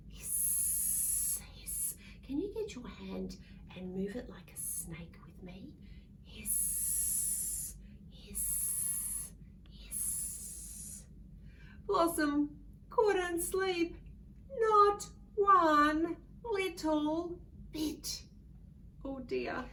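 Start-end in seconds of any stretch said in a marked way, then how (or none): 0.1-1.9 much softer, breathy hiss; snake hiss. Acted. 2.3-11.0 soft whispered snake hisses; whispered game. Acted. Quiet snake game with kids. 11.9-18.2 higher, louder, slow lament; emphatic refrain. A narrator's reading.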